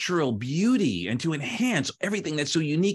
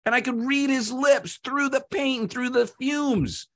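high-pitched, dramatic anguish; distressed clay; 10 semitones higher than usual. Acted. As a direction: keep straining high through the ordeal. Clay suffers through the fumes.

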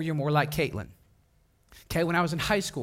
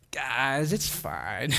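low, laid-back slacker calm; Nick; 4 semitones lower, 3 dB quieter, much slower than usual. Retold or imitated. Friend in the back seat reassuring panicking girls, mock laid-back. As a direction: imitate a totally unbothered friend, voice dropped low. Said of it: "he's in the back going".

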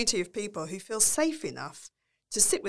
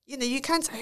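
usual speaking voice

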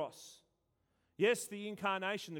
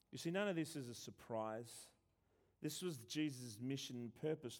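normal talking voice

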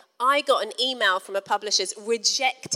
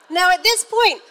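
ordinary talk

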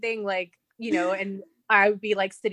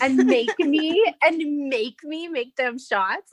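indignant whine; 6 semitones higher, much slower than usual. Retold or imitated. Indignant retold complaint about his flirting.

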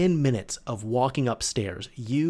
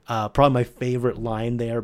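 usual speaking voice

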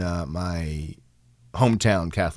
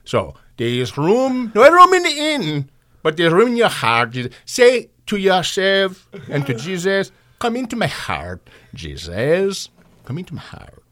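put-on slow, sing-song Indian-accented sermon; 2 semitones higher than usual. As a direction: gently preach in an Indian accent. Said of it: "He's saying donkey".

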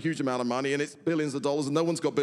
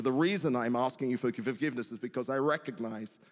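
ordinary talk, nothing marked

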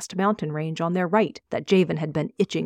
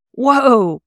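sudden loud, high-pitched whoa; startled whoa; 7 semitones higher, 9 dB louder than usual. Acted. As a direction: jolt back in surprise. Nearly slams into frail Aster.

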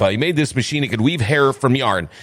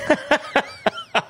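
laugh; 12 semitones higher than usual. Real, not acted. Laughing after being out-joked.

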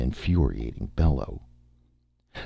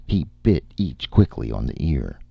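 ordinary delivery, nothing marked

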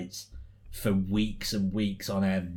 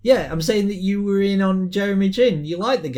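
excited teasing; 11 semitones higher, 9 dB louder than usual. Real, not acted. Teasing co-host about a player.

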